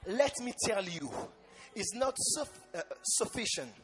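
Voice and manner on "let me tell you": ordinary talk, nothing marked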